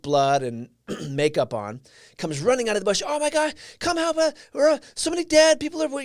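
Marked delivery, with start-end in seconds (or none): 3.1-6.0 high, panicked casualty calling for help; retold panic, pitched way up; freaking out. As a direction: act out a frantic victim crying for help. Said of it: "you know, just freaking out".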